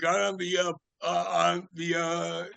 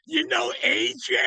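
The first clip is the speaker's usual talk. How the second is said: excited [name] voice; 11 semitones higher than usual. Retold or imitated. [name] asks the bartender about the club, shouting high with excitement. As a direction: call it out high and thrilled, stretching the name.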